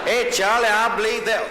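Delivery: loud, high Southern hollering; a Confederate soldier calling to a buddy; exaggerated Southern yell. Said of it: "speaking maybe too slow".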